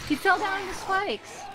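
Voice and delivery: high, upset wail; distressed. Real, not acted. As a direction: whine about the dog. Dog stuck in spikes.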